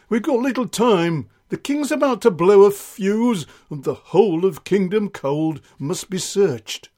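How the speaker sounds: brief the troops with dramatic urgency; urgent sergeant. Acted. Acts sergeant briefing the men.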